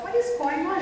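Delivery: high-pitched mock alarm; retold bewilderment. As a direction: act out a puzzled child's reaction. Retold startled children at strange sound.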